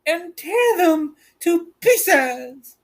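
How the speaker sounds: high, slow witch voice drawing out the order; wicked witch voice. A narrator's reading. The Wicked Witch ordering the wolves to kill, high slow witch voice. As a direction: savour the cruelty, slow and high. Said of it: "said the witch".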